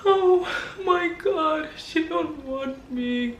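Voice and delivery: high, slow, hammed-up fake sobbing; mock crying. Mocking the ex crying over her, hammed-up sobbing. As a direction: wail like a heartbroken ex, overdone. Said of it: "Sliding down walls".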